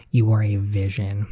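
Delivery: normal talking voice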